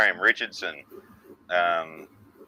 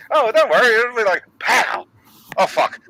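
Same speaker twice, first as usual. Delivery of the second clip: high and loud, confident turning to dismay; hammed-up boast collapsing into dismay; mock cocky then dismayed; 12 semitones higher, 5 dB louder than usual. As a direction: boast then crash into shock. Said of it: "that reaction of".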